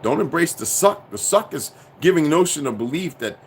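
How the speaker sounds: usual speaking voice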